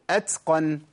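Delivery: أتقن with an s sound after the ت, atsqan; sin after ta. Shown as a demonstration. Teacher showing what to avoid, أتقن said as atsqan. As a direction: hiss an s out of the ت in أتقن. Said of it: "أجيب سين بعد التاء".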